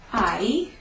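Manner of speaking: I drawn out like English "eye"; silent h. Shown as a demonstration. Teacher showing learners how hai differs from ha, I as "eye". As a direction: say I as a slow gliding "ai", no h. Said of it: "listen for the difference in the pronunciation".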